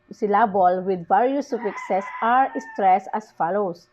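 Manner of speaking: ordinary talk, nothing marked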